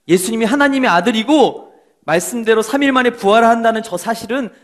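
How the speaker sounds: scornful priests. Acted. High priests refusing to believe, scoffing. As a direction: dismiss the resurrection with scorn. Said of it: "감추고 싶었어".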